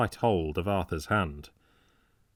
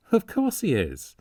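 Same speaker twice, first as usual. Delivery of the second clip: bright high-pitched reply as if amused; light-hearted, much higher answer; laughing; 10 semitones higher, 4 dB louder than usual. A narrator's reading. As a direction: toss it off high with a laugh behind it. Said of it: "answered Arthur, laughing".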